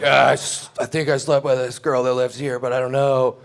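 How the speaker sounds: a hammed-up whiny confession, high and loud; mock sad confession. A drunk guy confessing to the girl, hammed-up sad whine. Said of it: "sad version of what happened".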